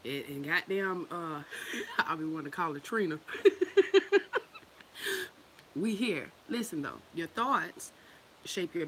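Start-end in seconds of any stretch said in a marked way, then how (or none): 3.2-4.6 shrieking burst of laughter; loud high laugh. Real, not acted. Laughs at the name mix-up.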